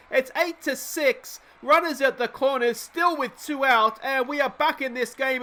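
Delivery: riding the high of the comeback; still excited. Real, not acted. Still buzzing after the hit.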